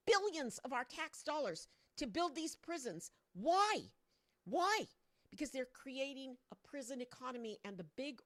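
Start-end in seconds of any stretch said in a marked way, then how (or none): 3.3-4.9 demanding why. Real, not acted. Demanding repeated question.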